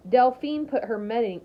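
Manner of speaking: ordinary delivery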